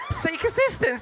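high, laughing delight; excited. Retold or imitated. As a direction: squeal it in awe. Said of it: "I'm like".

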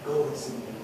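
Jesus forgiving the woman, soft and tender; gentle Jesus. Acted. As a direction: let it go quietly, full of mercy. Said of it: "the gentle spirit of Jesus".

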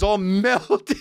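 breaking into a laugh mid-phrase; real laugh through the words. Real, not acted. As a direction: crack up on the punchline. Breaks up telling the punchline.

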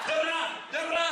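spat-out mock gunshot "Dra"; gun noise. Kid playing guns with a stick, gunshot noise. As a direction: shoot with your mouth like a kid. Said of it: "three noises for guns".

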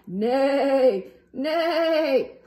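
loud neighing horse; loud whinnying neigh. Acted.

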